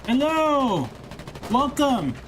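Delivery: goofy high greeting. Pretend.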